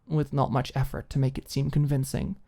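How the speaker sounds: ordinary delivery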